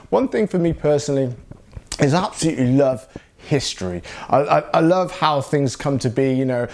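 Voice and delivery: usual speaking voice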